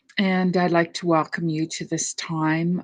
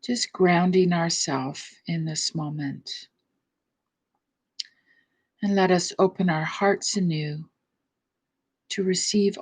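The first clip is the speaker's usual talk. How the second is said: guided meditation; 3 dB quieter than usual. Acted. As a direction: ease the listeners into stillness, unhurried.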